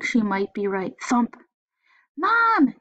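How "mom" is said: very high, loud cry for Mom; scared kid yelling for Mom. Acted. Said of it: "he yelled".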